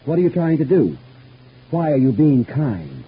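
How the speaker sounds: suspicious demand. Acted. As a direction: press him hard for his real motive. Presses visitor on his motives.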